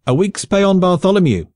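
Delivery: high, loud shouted wager; shouted bet. A narrator's reading. A soldier shouts his wager on Bartholomew, loud and high. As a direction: yell the bet over the crowd. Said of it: "shouted another".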